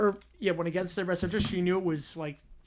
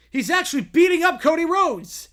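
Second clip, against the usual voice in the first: excited; 8 semitones higher, 10 dB louder than usual. Real, not acted. Excited reaction to story.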